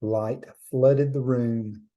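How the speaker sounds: ordinary delivery, nothing marked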